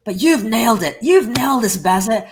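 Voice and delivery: emphatic, punchy mimicked praise; imitated enthusiastic praise. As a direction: gush like a won-over fan. Retelling the customer's emphatic praise.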